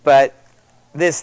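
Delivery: ordinary delivery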